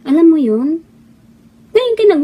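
usual speaking voice

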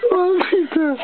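sharp high gasp of disgust; shocked. Real, not acted. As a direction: recoil in shock. Reacting to the bloody mouth.

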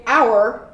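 "Our" said with a clear R, like the letter; one syllable "Our", the R sounded; r-colored our. Shown as a demonstration. Teacher showing "Our" with its R. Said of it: "We also just pronounce the R".

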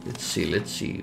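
breathy whisper to himself. Real, not acted. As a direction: whisper it under your breath. Muttering to himself while playing.

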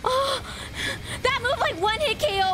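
high, wailing panic; real panic. Real, not acted. Player facing a one-hit KO, high panicked wail. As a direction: wail it helplessly, pitch way up.

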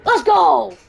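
loud celebrating cheer; triumphant shout. Real, not acted. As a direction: celebrate the win loudly. Winning the match.

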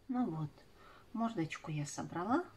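ordinary delivery

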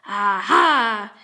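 usual speaking voice

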